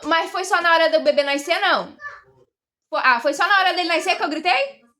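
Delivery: loud call to someone off in another room. Real, not acted. Wife calling to husband about the birth, calling out loudly.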